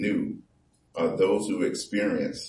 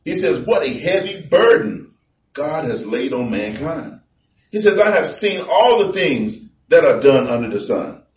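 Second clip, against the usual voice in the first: preaching at full force; 6 semitones higher, 8 dB louder than usual. Acted. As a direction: boom the verses out to the congregation. Preacher reading scripture loudly.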